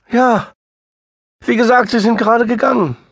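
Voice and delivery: high, loud, fast and frantic; frantic sister near tears; distraught woman. A narrator's reading. Sister answers.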